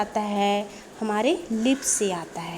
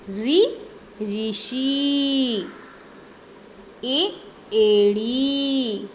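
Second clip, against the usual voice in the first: drawn-out teacher's vowel drill; slow primer read-aloud, words held long; alphabet recitation; much slower than usual. Shown as a demonstration. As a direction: stretch the long vowels on a steady note. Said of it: "पढ़ करके सुनाने वाले हैं".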